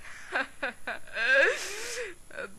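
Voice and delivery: long laugh. Acted. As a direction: keep laughing at them. Laughs during the scuffle.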